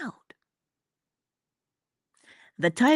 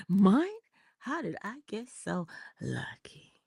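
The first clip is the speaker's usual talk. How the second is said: dreamy adoring whisper; 2 semitones higher than usual. Acted. Acting a man adoring his partner.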